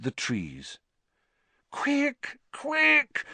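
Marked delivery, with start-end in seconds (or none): 1.7-3.2 very high, pleading moan; anxious moan. Acted. Hermione desperate to get Buckbeak hidden, anxious moan. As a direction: moan it in frantic urgency. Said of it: "Hermione moaned".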